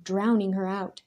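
plain reading voice

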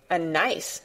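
plain reading voice

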